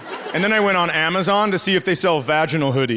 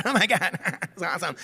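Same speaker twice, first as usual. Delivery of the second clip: giggly scroller; 6 dB quieter than usual. Retold or imitated. Mimics phone user's instant reaction.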